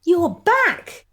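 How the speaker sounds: light up at the sight of him; surprised delight. Acted. Greeting Ivan as he walks back in, delighted surprise.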